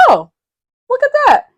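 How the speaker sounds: high, loud mock surprise. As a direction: squeal it out like spotting a gift. Mock surprised reaction retold.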